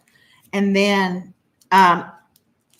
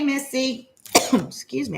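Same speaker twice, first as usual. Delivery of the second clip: usual speaking voice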